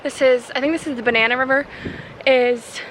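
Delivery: talking loudly over strong wind; windy clifftop. Real, not acted. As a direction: raise your voice over gusting wind. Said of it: "Sorry if you can't hear me over the wind".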